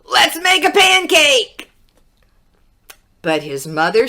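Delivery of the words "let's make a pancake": high, loud excited shout; eager shout. A narrator's reading. Jack begging his mother for a pancake, shouting. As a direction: belt it out like an impatient boy. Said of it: "shouted Jack".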